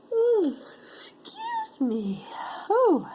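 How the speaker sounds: high-pitched talk through a yawn; yawning through an apology. Real, not acted. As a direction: let a big yawn stretch the words.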